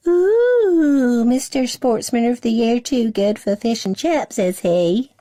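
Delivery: high, mocking sing-song tease; teasing little girl. A narrator's reading. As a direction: tease him like a cheeky sister. Sister teases brother.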